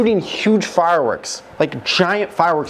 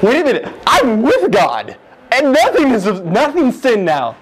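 higher and louder, thrilled discovery; excited realization; 8 semitones higher, 4 dB louder than usual. Retold or imitated. Speaker realizing in heaven he is free, excited and louder. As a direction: burst out with joyful realization.

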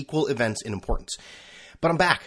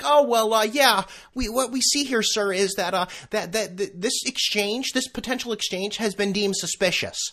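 high, prissy support-agent voice; a lightly hammed-up polite agent; mock customer service rep; 9 semitones higher than usual. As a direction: ape the scripted phone rep. Mocking PayPal customer service rep.

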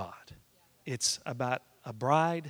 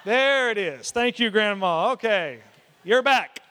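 loud, raised sarcastic thanks; mock exclamation; 6 semitones higher, 9 dB louder, slower than usual. Grandson answering grandma's backhanded compliment, loudly sarcastic. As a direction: call it out big, as if vindicated.

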